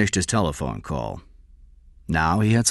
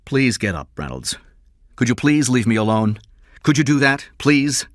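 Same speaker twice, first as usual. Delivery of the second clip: raised, insistent request; strained plea; 6 semitones higher, 6 dB louder than usual. Acted. King to a kneeling father begging him, strained plea. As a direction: plead to be left alone. King asks to be left alone.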